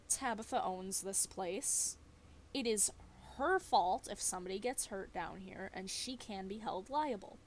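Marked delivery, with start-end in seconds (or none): none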